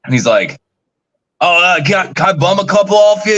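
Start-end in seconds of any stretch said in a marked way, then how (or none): 1.4-3.4 higher-pitched imitation Australian; Australian accent. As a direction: put on an Australian bloke's accent. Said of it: "he's got this Australian accent".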